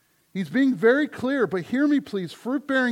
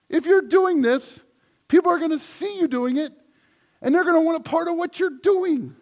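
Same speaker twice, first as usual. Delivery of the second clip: louder, higher, driving the point home; fervent preaching; 8 semitones higher, 5 dB louder than usual. Real, not acted. Pastor urges congregation that fruit draws people, fervent and loud. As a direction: preach it out with full conviction.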